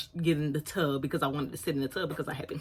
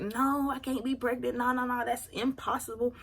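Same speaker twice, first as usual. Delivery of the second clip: raised, insistent denial; 7 semitones higher than usual. Retold or imitated. To her mom, denying pregnancy, insistent. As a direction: protest a little too much.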